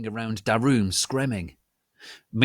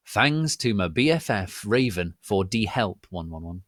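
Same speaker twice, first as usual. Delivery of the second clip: plain reading voice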